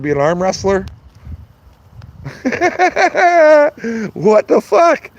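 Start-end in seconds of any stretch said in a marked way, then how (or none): 2.0-4.1 bursts into a high cackle; big squealing laugh; loud high laugh. Real, not acted. Laughing at his own joke. 4.1-5.1 high and loud through laughter; cracking up in disbelief; laughing disbelief. Real, not acted. Still laughing after joke.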